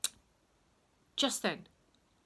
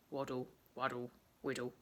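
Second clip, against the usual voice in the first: low playful sing-song; 6 semitones lower, 5 dB quieter than usual. A narrator's reading. Sound words for waddling.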